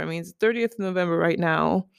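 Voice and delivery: ordinary delivery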